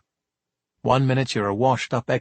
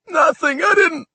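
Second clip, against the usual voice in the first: crying plea; 5 dB louder than usual. Acted. Sobbing kid begs the cops, wailing. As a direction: cry like a toddler. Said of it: "The sobs racked his big body".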